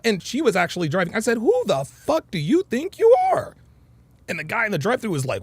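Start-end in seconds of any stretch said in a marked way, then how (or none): 1.4-3.6 re-enacted yell, pitched well up; retold yell. Man yelling back at his partner in the car, retold high yell. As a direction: throw out a high, outraged challenge. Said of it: "I yelled it loud and proud".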